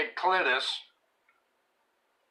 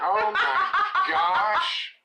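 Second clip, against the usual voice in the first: very high, drawn-out words through laughter; helpless laughing; 4 dB louder, much slower than usual. Real, not acted. Host reacting to a terrible name, laughing helplessly. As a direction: gasp it out while cracking up.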